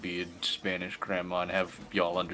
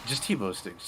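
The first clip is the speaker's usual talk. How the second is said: ordinary talk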